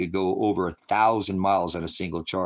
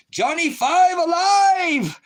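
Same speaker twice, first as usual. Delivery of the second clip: much higher, loud, slow robot exclamation; robot cry; 8 dB louder, much slower than usual. Retold or imitated. Streamer shouting the robot's catchphrase, much higher and slow. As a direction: stretch it out like a cheerful movie robot.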